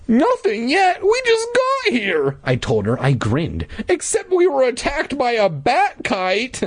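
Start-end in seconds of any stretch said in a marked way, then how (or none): 0.1-2.4 very high, squeaky put-on kid; child hero voice. Hero asking Louisa about fun, squeaky kid voice. 3.9-6.6 very high, squeaky put-on kid; child hero voice. Hero asking Louisa about fun, squeaky kid voice.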